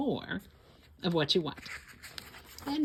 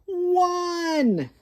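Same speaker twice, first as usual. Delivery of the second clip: very high, loud call-out; excited answer; 12 semitones higher, 6 dB louder than usual. A narrator's reading. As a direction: shout the answer to the kids. Counting buttons left.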